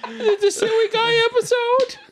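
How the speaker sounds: very high laughing squeal; squealing laughter. Real, not acted. Co-host teasing about the silliest episode, squealing with laughter. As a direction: squeal it out through helpless laughter.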